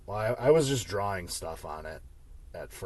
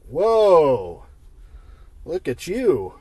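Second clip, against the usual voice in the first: loud, higher, teasing whoa; mock surprised; 7 semitones higher, 12 dB louder, much slower than usual. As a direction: burst out with a playful whoa. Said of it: "I was like".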